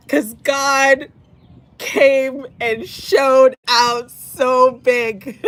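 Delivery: very slow, high, tearful voice; overcome, drawing words out; choked-up joy; still teary. Real, not acted. Emotional reader overcome with joy.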